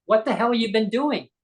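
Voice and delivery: high, pressed mock outburst at Valerie; retold outburst. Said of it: "I lost it".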